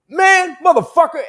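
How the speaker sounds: loud high yell of outrage; a man's shout from the crowd; mock angry shout. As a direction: bellow it across the room. Brawl breaking out, voiced shout.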